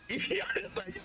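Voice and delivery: high, laughing delight; tickled, giggly reaction; amused laughing. Real, not acted. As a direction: laugh through the words, pitched well up. Cracking up at the name.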